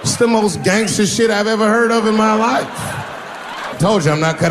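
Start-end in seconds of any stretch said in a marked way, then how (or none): none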